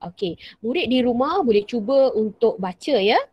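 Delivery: normal talking voice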